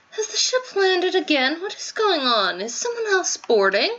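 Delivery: put-on high, puzzled young girl voice; lightly voiced anxious girl asking around; startled girl. Voicing game character lines.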